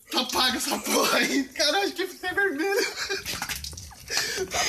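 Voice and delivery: excited laughing relief. Real, not acted. Relief after ice challenge, laughing.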